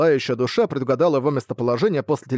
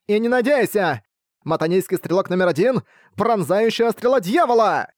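shouted skill call; 7 semitones higher than usual. A narrator's reading. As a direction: yell the attack name. Archer yelling skill.

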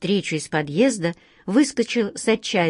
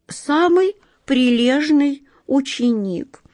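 скромно; 8 semitones higher, slower than usual. Acted. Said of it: "скромно и добродетельно".

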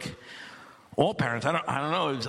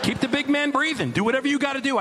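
emphatic plea; 7 semitones higher than usual. Acted. Comic plea to keep him alive.

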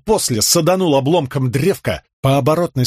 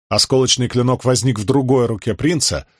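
usual speaking voice

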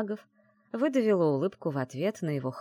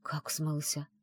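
softer, understated bewilderment; taken aback; 6 dB quieter, slower than usual. A narrator's reading. Heroine hearing he may have fled, taken aback. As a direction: ask it as if stunned. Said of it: "опешила я".

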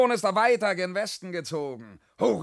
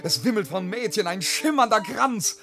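exhilarated; 5 semitones higher, 3 dB louder than usual. Acted. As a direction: get swept up by the dance. Hears dance music, fired up.

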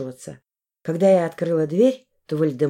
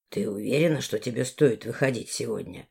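Valdemar voice; 7 semitones lower, 6 dB quieter than usual. Acted.

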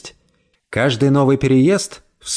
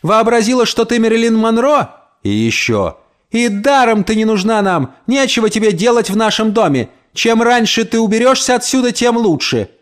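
yelling insults; 8 semitones higher than usual. Acted. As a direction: hurl it after her down the street. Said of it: "она заорала мне вслед". (хозяйка кричит вслед жиличке, пронзительно и громко)

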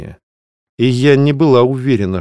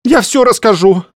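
panicked squeal; 12 semitones higher, 4 dB louder than usual. Acted. Terrified book confesses.

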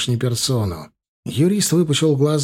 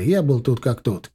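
plain reading voice

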